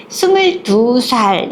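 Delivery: put-on very slow, drawn-out childish answer; little girl playing grown-up. Daughter inflating her age to her mother, slow and playful. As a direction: stretch it out like a proud little kid. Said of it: "나이를 많이 부풀려서".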